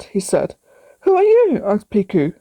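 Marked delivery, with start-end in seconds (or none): 1.0-1.6 startled. A narrator's reading. Sudden high loud question.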